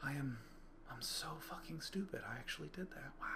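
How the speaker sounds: near-whispered disbelief; very quiet. Retold or imitated. Jumper looking out in the car afterwards, very quiet, almost whispering. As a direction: murmur it to yourself, stunned. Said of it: "I was just very quiet".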